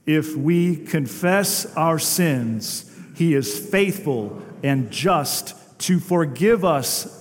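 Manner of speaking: slow, deliberate, every word spaced; unison recitation. Shown as a demonstration. Pastor leads the congregation reciting First John together, slow and measured. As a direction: lead the room through the verse slowly. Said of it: "say it together in unison".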